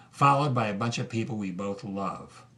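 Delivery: normal talking voice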